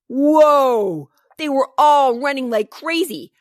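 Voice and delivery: loud, high excited yell; thrilled shout. Acted. Wild chase in story.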